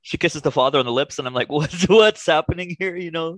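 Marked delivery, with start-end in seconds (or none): 1.5-3.0 higher, louder, drawn-out puzzled reaction; mock bewilderment. Podcaster recalling reaction to father kiss, mock bewildered. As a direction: stretch out the question in mock disbelief.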